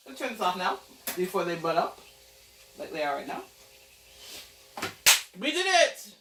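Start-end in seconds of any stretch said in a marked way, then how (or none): 5.4-6.1 excited. Real, not acted. Celebrating end of cooking.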